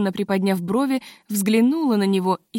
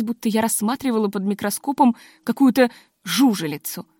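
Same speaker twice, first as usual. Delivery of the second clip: plain reading voice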